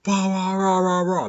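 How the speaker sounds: loud, high mock roaring; Hulk roar. Showing how the Hulk fights, mock roaring. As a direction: imitate a raging brute with spelt-out roars. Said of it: "the Hulk is just kind of like".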